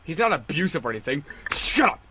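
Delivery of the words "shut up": sudden high, loud shout; angry shout. Acted. To the girl defending her boyfriend, a high angry shout. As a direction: snap it out like you've had enough. Snapping at chat partner.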